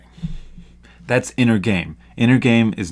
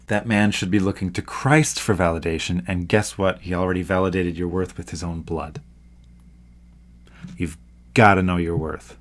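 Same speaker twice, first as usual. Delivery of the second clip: ordinary talk, nothing marked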